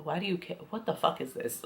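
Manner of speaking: quiet, breathy disbelief; half-whispered what is this; hushed disbelief. Retold or imitated. Her own imagined incredulous reply.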